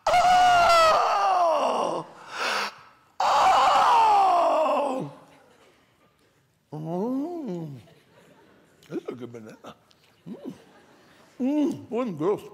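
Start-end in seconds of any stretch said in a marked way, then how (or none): 0.1-5.1 long, much higher, much louder rapturous oh; hammed-up delight at the banana; ecstatic drawn-out oh. First bite of peeled banana. 6.7-11.9 mock yum. Enjoying the banana.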